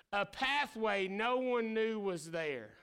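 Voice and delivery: ordinary talk, nothing marked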